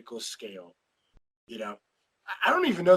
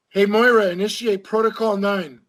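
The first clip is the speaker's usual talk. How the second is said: calling out a command; 7 semitones higher, 10 dB louder than usual. Pretend. Calls command to show's assistant.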